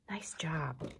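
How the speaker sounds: soft whispered praise, barely voiced. Real, not acted. Teacher whispering praise to a young pupil.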